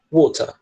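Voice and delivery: water with a crisp T and no final R, wo-tah; water britânico, com T bem marcado; British water. Shown as a demonstration. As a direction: say water the British way, T hit clean. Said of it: "o T pra eles é um T mesmo".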